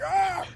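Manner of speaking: very high exasperated yelp; frustrated cry. Retold or imitated. End of the argument with father, high frustrated yelp.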